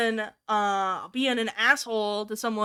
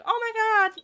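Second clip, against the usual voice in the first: exasperated; 12 semitones higher than usual. Retold or imitated. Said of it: "I'm like, like".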